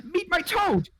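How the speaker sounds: loud, high burst of delight; sudden eager squeal of an eccentric host; excited introduction. Acted. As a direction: proudly shout out your pet's introduction. Abruptly introduces her pet toad.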